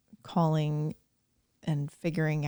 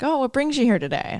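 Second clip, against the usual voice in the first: cheerful high greeting; chirpy receptionist; 8 semitones higher, faster than usual. Retold or imitated. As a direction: play the cheery front desk guy. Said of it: "and the guy's like".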